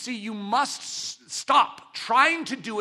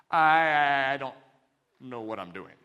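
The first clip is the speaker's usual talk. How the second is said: acted bewildered confession; helpless confession; 6 semitones lower than usual. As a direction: admit you're lost, trailing down. Voices the young sculptor's confession.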